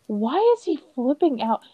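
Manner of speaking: a mock-baffled child's question; imitating daughter. Mom imitating her daughter watching an old movie, lightly puzzled.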